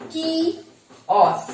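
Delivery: কিয়স split very slowly, high kee, then oss; syllable-by-syllable demonstration. Shown as a demonstration. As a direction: stretch কিয়স into two separate syllables. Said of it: "এইভাবে বলবে, দেখো".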